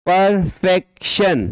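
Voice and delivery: परफेक्शन in three beats, पर-फेक-शन; each syllable of परफेक्शन given its own beat; three-beat demonstration. Shown as a demonstration. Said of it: "three syllable".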